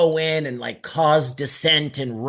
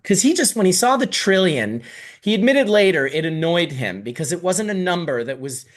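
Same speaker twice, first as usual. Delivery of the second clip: normal talking voice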